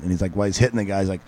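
usual speaking voice

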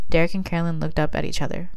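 ordinary delivery, nothing marked

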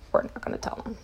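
breathy whisper, a private vow; whispered to herself. Retold or imitated. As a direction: whisper it to yourself like a secret. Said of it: "I told myself".

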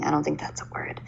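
soft whisper under the breath; whispered aside. Real, not acted. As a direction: whisper it to yourself. Self-doubting aside mid-review.

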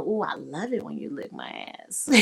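a hint of bedroom moan; soft dirty talk. Shown as a demonstration. Demonstrating dirty talk lines.

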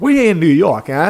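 mock New Yorker tough talk, New York accent. To his wife about Chicago honking, hammed New York accent. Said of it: "nobody has ever talked like that".

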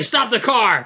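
high-pitched mock alarm; retold panic. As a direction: imitate a sudden panicked command. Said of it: "horror and fear on the guy's face".

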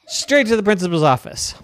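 loud, high commanding teacher; bossy teacher orders. Acted. Girl orders class to principal, loud and bossy. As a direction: order them out the door.

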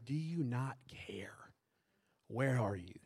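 soft, slow, breathy whisper of a prayer; a whispered cry of doubt to God; hushed plea. Acted. Voicing a desperate prayer.

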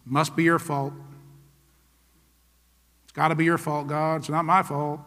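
low, quietly spoken mock excuse; blame-shifting. Preacher voicing believers who blame God, blame-shifting. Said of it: "that's called no-fault religion".

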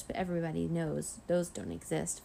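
usual speaking voice

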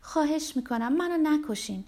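high-pitched begging; acted, imploring plea; pleading. A narrator's reading. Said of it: "التماس کرد".

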